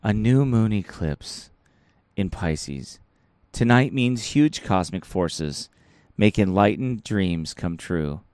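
low, slow spooky mystic; creepy voice. Pretend. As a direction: read it like a creepy fortune teller. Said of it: "I'll say it in my creepy voice here".

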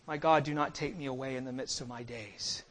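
low, soft, solemn plea; hushed prayer. Acted. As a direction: pray it quietly, voice dropped right down. Voicing Christ's prayer to the Father.

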